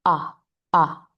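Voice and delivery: two short, open "Ah" sounds; projected O. Shown as a demonstration. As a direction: say "Ah" from the back of an open throat. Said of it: "opening up and projecting the sound forward".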